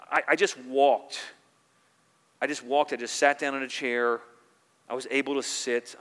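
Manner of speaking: loud zoom-in thanksgiving prayer; fervent thanks. Acted. Pastor voicing a believer thanking God for small things, loud and fervent. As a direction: list small blessings with fervour.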